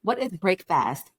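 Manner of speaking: "breakfast" slowly as two words, BREAK FAST; mock unfamiliar word. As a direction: sound out "breakfast" as if never heard before. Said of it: "Are you trying to say break fast?".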